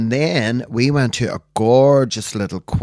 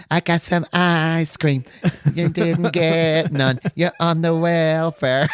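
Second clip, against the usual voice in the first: put-on much higher, slow taunting child voice; bragging kid; 8 semitones higher, slower than usual. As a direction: tease like a smug little kid. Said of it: "you know when kids teach each other?".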